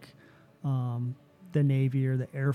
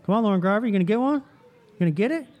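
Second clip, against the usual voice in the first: higher, louder prodding questions; goading call-out; 8 semitones higher, 5 dB louder, much faster than usual. Real, not acted. Urging Lauren Garvey to get one, goading call-out. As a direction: push them to go for it, pitched up.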